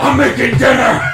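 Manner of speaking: high, loud manic shout; maniacal scream. Acted. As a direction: yell it like a raving maniac. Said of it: "this scream in the maniacal laughter".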